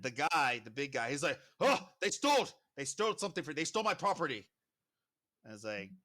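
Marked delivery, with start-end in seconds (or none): 1.6-4.5 high, loud outcry, easing slightly; outraged accusation. Acted. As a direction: cry out the theft to the guards. Owner accuses thieves before guards.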